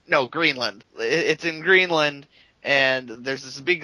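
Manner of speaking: ordinary talk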